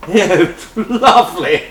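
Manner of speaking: giggling through the words; laughing. Real, not acted. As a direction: laugh out the reply. Laughing at the rude joke.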